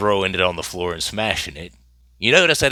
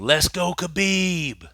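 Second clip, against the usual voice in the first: imitated shout; 10 semitones higher, slower than usual. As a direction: mimic someone in a crowd calling out. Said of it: "someone is heard shouting".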